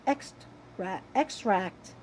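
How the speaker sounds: ordinary delivery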